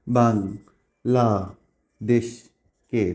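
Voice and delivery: "বাংলাদেশকে" split very slowly as বাং, লা, দেশ, কে; four separate drawn-out beats on "বাংলাদেশকে"; syllable by syllable. Shown as a demonstration. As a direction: count out "বাংলাদেশকে" with a pause after each syllable. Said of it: "এখানে কয়টা syllable আছে".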